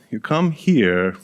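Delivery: usual speaking voice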